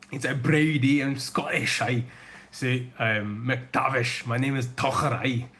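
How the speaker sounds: hammed-up Scottish brogue like an old grandfather; Scottish accent. Streamer tries his grandfather's Scottish accent for chat, hammy Scottish accent. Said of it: "I picked up the accent. I'm not doing it well".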